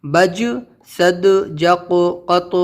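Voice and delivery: بج سد جق قط with a bouncing echo on the final letter; قلقلہ کے ساتھ بج سد جق قط; qalqala echo. Shown as a demonstration. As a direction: let the last letter bounce back. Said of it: "ان کی آواز پلٹ کر واپس آتی ہے".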